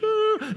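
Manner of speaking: high startled grunt. Acted. Woman meeting the disciples, a startled high uh. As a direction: let out a sudden high uh.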